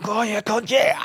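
high, rising hammed-up gym cry; mock gym shout. Lifting in the garage, psyching himself up with a high mock shout. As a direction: pump yourself up mid-lift. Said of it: "now I'm fucking going".

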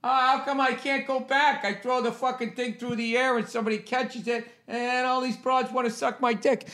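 high, soft whiny jock voice; mock dumb jock. Imitating a college quarterback complaining about campus closing, in a high whine. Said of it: "you're like".

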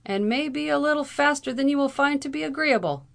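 high, loud retort; exclaiming. A narrator's reading. Lawyer calling back to the angry captain, high exclamation. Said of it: "exclaimed Howard".

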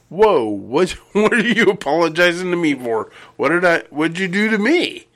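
high, incredulous mock bafflement; retold astonishment. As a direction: act out being baffled, pitch climbing on the questions. Retold puzzled reply to an apology.

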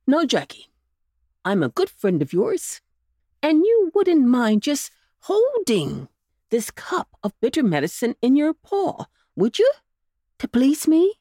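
lightly voiced coaxing uncle; coaxing old rabbit. A narrator's reading. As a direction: win the pup over gently.

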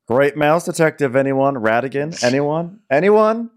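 rising, playful appeal to the room; mock pleading for takers. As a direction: call out hopefully for any takers. Fishing for reaction to a reference.

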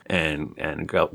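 normal talking voice

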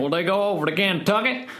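a hammed-up Kentucky accent on request. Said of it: "Could you do a Kentucky accent for me?".

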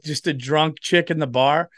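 ordinary talk

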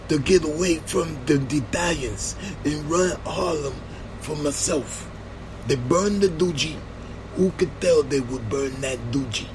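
soft, muttered self-pity; quiet lament. Retold or imitated. Bumpy's lament voiced quietly.

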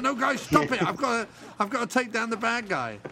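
a cop begging his backup to shut up; frantic cop plea. Pretend. Cop begging chanting crew to stop.

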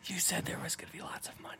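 whispered joking complaint. Pretend. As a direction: hush it like a secret gripe.

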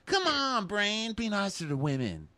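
high, drawn-out mock wail to own brain; whiny big baby. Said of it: "Fucking baby".

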